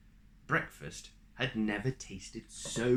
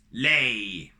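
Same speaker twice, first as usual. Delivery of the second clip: loud single command; commanding bark; 10 dB louder than usual. Acted. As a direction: order the hen like a giant. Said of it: "commanded the giant".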